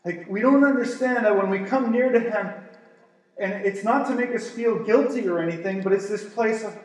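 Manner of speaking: ordinary talk, nothing marked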